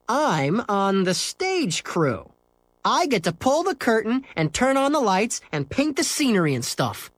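lofty. Acted. Scooter boasting about stage crew, lofty and high. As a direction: show off with airs. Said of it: "said Scooter loftily".

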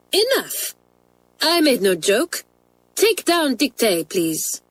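high, exasperated schoolmistress; stern rebuke. A narrator's reading. French teacher quelling a giggling class, stern.

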